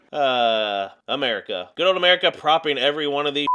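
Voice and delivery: ordinary talk, nothing marked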